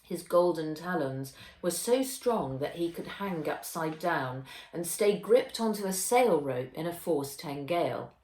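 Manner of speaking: usual speaking voice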